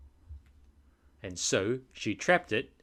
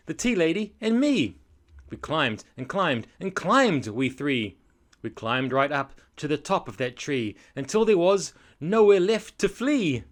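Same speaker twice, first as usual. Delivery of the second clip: dramatic story narration; 4 semitones higher, 6 dB louder than usual. A narrator's reading. Narrating the climb up the tree, building excitement. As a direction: climb higher and louder.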